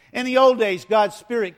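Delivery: loud, high preaching burst; forceful pulpit call. Real, not acted. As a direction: belt it out to the congregation.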